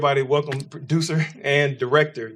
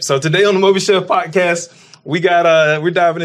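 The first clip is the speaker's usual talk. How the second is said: loud, pitched-up announcer energy; hype host intro; 5 semitones higher, 10 dB louder than usual. Real, not acted. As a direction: sell the show big. Hyping the podcast intro.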